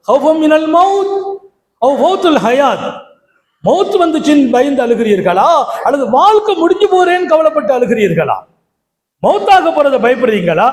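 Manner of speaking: high, pressing sermon questioning. Acted.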